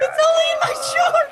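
high squeaky laughing voice; laughing hard. Real, not acted.

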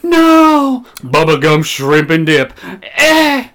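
tipsy slurred rambling. Real, not acted. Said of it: "Sorry, I'm tipsy".

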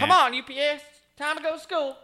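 put-on high, loud mom calling kid; squeaky hammed-up mom voice; parent voice.

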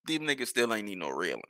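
ordinary talk, nothing marked

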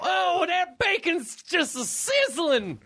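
hammed-up zany radio host with sound effects; morning zoo DJ. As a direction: shout it like a wacky morning radio DJ. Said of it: "we are officially Morning Zoo Radio now".

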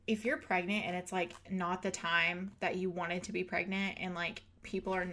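usual speaking voice

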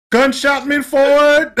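high, loud, drawn-out Jamaican shout; mock Jamaican. Host hyping the replay, slow loud mock Jamaican. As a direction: throw out a playful Jamaican dancehall call.

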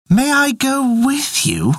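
high, slow pleading cat. Acted. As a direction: ask slowly like a small cat. Cat asks to come.

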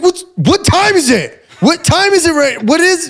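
high, frantic mock voice; panicked friend. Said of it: "He's like".

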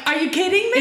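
high, incredulous yelp; mock disbelief. Joe reacting to her question, high mock disbelief. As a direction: pitch it way up, astonished. Acting Joe's stunned reply.

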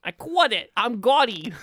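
loud, high Boston gangster voice; Gotti-style Boston accent, hammed up. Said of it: "You're really becoming John Travolta's Gotti".

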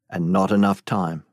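plain reading voice

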